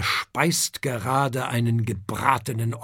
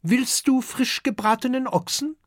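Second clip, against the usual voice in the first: very high-pitched dragon-mother offer; female dragon hostess; 13 semitones higher than usual. A narrator's reading. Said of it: "fragt den kleinen Gast". (hohe Gastgeberinnenstimme)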